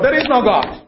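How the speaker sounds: high, mock-defiant shout; a neighbour calls out to contradict her; atheist's shout. Atheist neighbour yells back at the praying lady, in a high mock shout.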